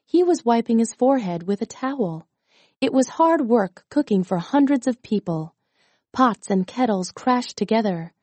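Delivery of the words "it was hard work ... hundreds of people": ordinary delivery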